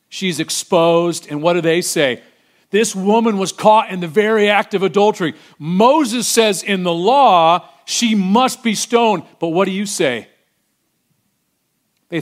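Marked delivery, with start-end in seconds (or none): 2.7-9.2 accusing leaders. Acted. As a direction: condemn her before the crowd. Religious leaders accusing the woman.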